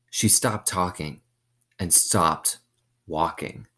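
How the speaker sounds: usual speaking voice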